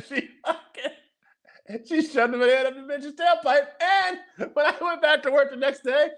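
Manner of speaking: high voice squeezed through real laughter; helpless high-pitched laughing talk. Real, not acted. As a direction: keep telling it while cracking up. Cracking up at own story.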